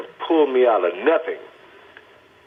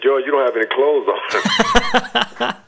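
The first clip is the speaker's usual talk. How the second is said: laughing while quoting; 2 semitones higher, much slower than usual. Retold or imitated. Retelling staff holding him down.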